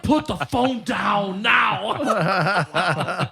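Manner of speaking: high, very slow, loud order; laughing mock shout. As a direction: drag out the command while laughing.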